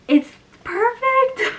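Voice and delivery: high, loud, drawn-out squeal; delighted squeal. Real, not acted. Book creator thrilled by an undamaged replacement, delighted squeal. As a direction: squeal it slowly with real delight.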